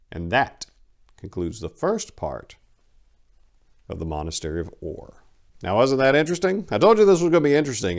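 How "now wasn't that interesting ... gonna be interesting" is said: fast, higher eager outro; excited. Real, not acted. Host talking to viewers after the story, excited fast. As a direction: rush in with enthusiasm.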